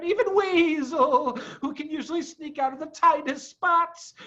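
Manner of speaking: high, distressed animal voice, slowing near the end; worried Badger. A narrator's reading. Badger telling Foxy of trapped friends, despairing. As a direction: despair creeping in.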